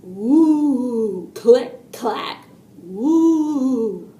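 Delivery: eerie drawn-out woos, crisp clicks; imitating alien robot. A narrator's reading. Kenny playing robot at dinner, imitating its spooky noises. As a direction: lightly play a spooky robot. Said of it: "held up a yellow cup up in front of her face and said".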